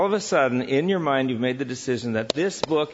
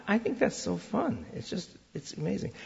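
higher, softer delighted aside; 7 semitones higher, 7 dB quieter than usual. Real, not acted. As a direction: gush a little, quietly. Delighted at the idea.

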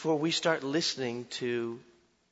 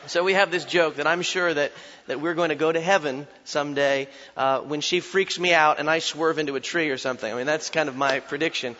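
usual speaking voice

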